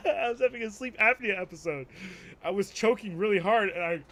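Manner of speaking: can't keep from laughing telling it. Real, not acted. Laughing retelling waking up choking.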